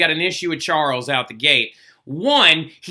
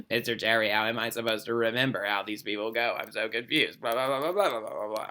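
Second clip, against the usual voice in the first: low, soft mock-whiny student voice; lightly mocking a lost student; whiny confused student; 7 semitones lower, 10 dB quieter, faster than usual.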